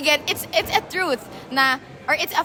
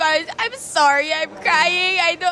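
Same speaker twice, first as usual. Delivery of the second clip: higher, drawn-out imitation of crying; retold crying, pitched up; mock tearful apology; 4 semitones higher, 3 dB louder, slower than usual. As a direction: act out a tearful, slowed-down sorry. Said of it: "Basically, umiyak ako, sabi ko".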